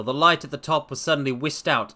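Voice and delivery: ordinary talk, nothing marked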